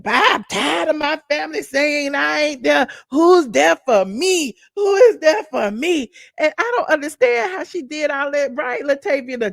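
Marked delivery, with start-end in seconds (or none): 0.0-6.1 mimicking angry rant. Retelling Portia cursing out production, raised mock shout. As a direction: rant like a wronged cousin, voice raised. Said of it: "she cursed out production".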